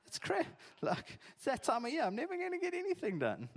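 high, put-on local voice complaining; mock Capetonian whine. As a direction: whine like a stressed local in November.

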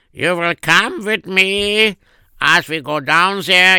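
lightly voiced father giving orders; old father calling son. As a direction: call out to the boy like an aging dad.

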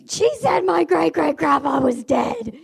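high, drawn-out aggrieved wail; retold screaming complaint. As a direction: imitate an outraged pupil wailing. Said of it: "she was screaming".

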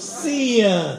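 "sin" stretched slowly into "see-un", loud; mock Southern "sin", broken into "see-yun"; two-syllable Southern sin. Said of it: "He put two syllables in the word sin".

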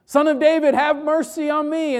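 loud, high-pitched desperate call. Retold or imitated. Blind beggar shouting to Jesus over the hushing, loud cry. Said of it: "Bartimaeus gets even louder".

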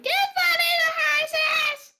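high, loud, slow shriek; shout. Acted. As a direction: scream it like a ringtone. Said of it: "do that one more time".

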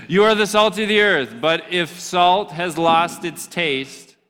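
preacher voice fired up again; energetic restart. Shown as a demonstration. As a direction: bring the energy back into the verse. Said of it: "listen, bring it back".

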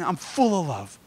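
slow, drawn-out imitation of grandpa; a hint of grandpa's heartfelt insistence; grandpa repeating himself. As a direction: insist with long, falling emphasis. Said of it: "He just kept repeating".

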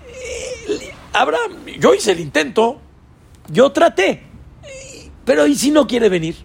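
worried servant talking to himself; Eliezer's inner doubt. Acted. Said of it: "Adentro, ¿qué conflicto hay?".

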